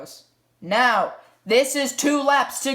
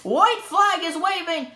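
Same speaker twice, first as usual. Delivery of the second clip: higher, driving play-by-play; motorsport commentator; 5 semitones higher than usual. Acted. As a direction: build the tension of the final lap.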